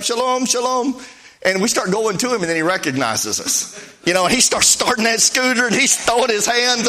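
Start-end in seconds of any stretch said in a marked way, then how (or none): 0.0-1.0 mock friendly rabbi, sung out higher; rabbi's cheerful greeting. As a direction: call out a cheery shalom like the waving rabbi. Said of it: "that rabbi throws his hand up".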